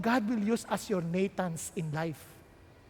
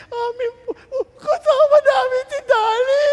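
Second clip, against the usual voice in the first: put-on high, loud wailing cry of children; crying shout; 12 semitones higher, 11 dB louder than usual. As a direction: wail that the lamb is theirs. Children crying for their lamb. (umiiyak na sigaw ng mga bata)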